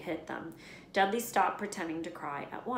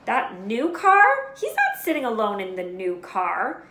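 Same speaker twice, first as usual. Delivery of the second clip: indignant uncle; 4 semitones higher, 11 dB louder than usual. A narrator's reading. Vernon objecting about car.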